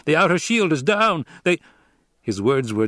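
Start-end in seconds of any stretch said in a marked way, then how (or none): 0.1-1.6 alarmed shout. Acted. Officer's alarm.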